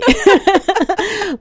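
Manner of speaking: a high, loud laugh at yourself. Real, not acted. Singer laughing at being spotted for her accent, high and loud.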